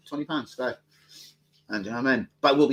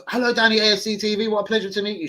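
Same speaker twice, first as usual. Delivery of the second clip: much higher and louder, gushing fan; high fawning fan; 9 semitones higher, 6 dB louder than usual. Retold or imitated. Fan meeting Danny at the stadium, high and gushing. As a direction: gush like a starstruck fan. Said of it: "the geezer when he walked up".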